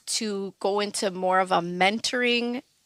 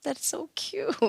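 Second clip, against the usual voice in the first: higher, softer mock-sweet condescension; condescending mom; 7 semitones higher, 9 dB quieter, slower than usual. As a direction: pat her on the head with your voice. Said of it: "And she's like".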